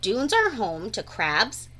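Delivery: usual speaking voice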